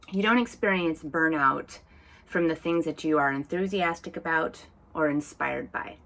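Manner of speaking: normal talking voice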